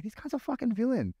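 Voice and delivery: high, amazed realisation; excited inner thought. Retold or imitated. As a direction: blurt it out high with glee. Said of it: "I'm like".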